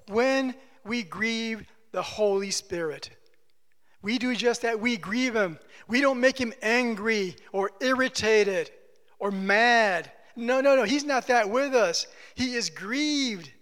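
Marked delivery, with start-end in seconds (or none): none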